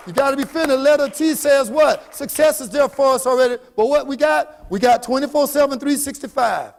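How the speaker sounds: loud call-and-response; rallying speaker working the room; motivational preaching. Real, not acted. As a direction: prompt the crowd with punchy questions.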